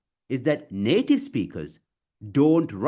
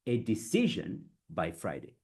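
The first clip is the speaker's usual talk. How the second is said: measured and softer, pausing between chunks; chunked delivery; 3 semitones lower, 5 dB quieter than usual. Shown as a demonstration. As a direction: model it phrase by phrase. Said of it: "native speakers do break it".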